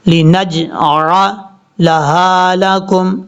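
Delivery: slow tajweed recitation; qalqala on jeem. Shown as a demonstration. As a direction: bounce the jeem in لِنَجْعَلَهَا. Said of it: "یہاں پر قلقلہ کریں گے".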